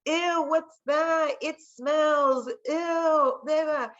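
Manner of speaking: put-on high, slow disgusted kid voice. Kid sneering at her lunch, mock disgusted. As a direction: sneer like a picky schoolkid. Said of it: "they'd be like".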